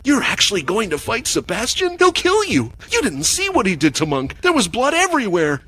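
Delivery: high, frantic and pleading; panicked. A narrator's reading. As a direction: panic rising in the voice.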